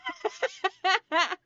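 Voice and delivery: real high giggle; laughing. Real, not acted. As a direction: burst into a high, amused chuckle. Amused at viewer's chat comment.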